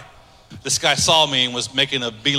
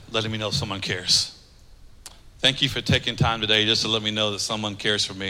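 much softer and lower, heavy; quiet grief; 6 semitones lower, 7 dB quieter than usual. Retold or imitated. Widower quietly thanking the pastor after the meal, subdued. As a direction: speak it low, like confessing a loss. Widower telling his loss.

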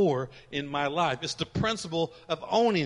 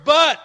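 "but," hit high and loud; big BUT; 10 semitones higher, 11 dB louder than usual. Shown as a demonstration. Preacher punching "but," for the congregation, shouted. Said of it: "we need some more buts in church".

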